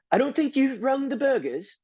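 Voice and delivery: high, prim, super proper voice. Retold or imitated. Mimicking the cashier's super proper British voice. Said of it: "super proper".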